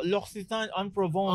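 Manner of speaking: playful put-on French accent. Host echoing co-host's amenity kit brand, mock French accent. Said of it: "pag marunong ka mag-French".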